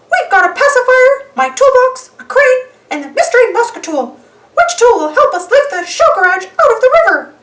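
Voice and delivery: high, bright cartoon mouse; squeaky mouse listing tools; Mickey Mouse voice. Mickey's voice held.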